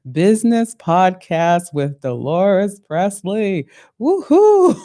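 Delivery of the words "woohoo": a big high whoop of joy; excited cheer. Real, not acted. Host cheering the launch of her first episode, excited whoop.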